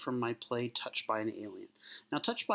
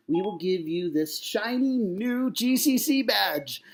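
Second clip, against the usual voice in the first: high, emphatic stage pitch to an alien; captain addressing an alien; 5 semitones higher, 6 dB louder than usual. Acted. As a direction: sell it grandly, key words stretched. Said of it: "talks to one of the aliens".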